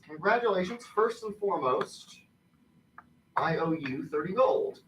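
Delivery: a hint of a pleased old host; old man voice. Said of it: "he says".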